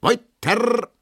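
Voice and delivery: dance master calls the dancers back onto the floor; calling out. Acted. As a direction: call the dancers on. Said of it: "ruft der Tanzmeister".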